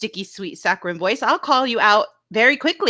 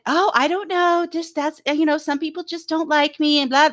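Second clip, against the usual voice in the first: higher, mock-innocent voice; mimicking her excuse; 5 semitones higher than usual. Host mimics the panel guest asked about the chat, mock-innocent. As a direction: put on her helpless excuse, pitched up.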